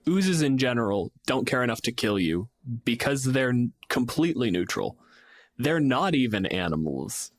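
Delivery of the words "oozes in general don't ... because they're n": ordinary talk, nothing marked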